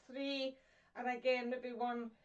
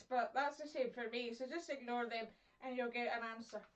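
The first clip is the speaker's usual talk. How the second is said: ordinary talk, nothing marked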